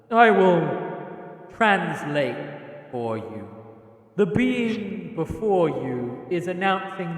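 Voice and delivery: grave, measured tree spirit voice; ancient tree lord. Acted. Tree Lord translating the forest god for the travelers, solemn. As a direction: speak as an old forest being, solemn.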